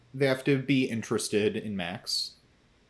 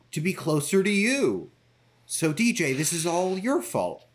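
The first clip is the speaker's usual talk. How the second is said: rising mock accusation; 4 semitones higher than usual. Quoting Doctor Harmon blaming DJ.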